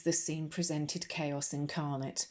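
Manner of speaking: ordinary delivery, nothing marked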